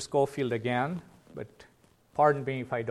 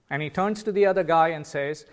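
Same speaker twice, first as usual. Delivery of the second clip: normal talking voice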